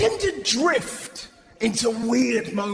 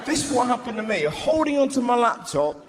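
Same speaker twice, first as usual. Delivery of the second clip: ordinary talk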